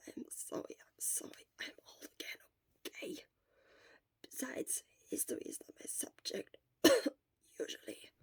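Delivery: hoarse sick whisper, barely any voice; Shota's voice. Acted. Shota whispering weakly to his friend from the sickbed. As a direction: whisper it like a sick man worn out.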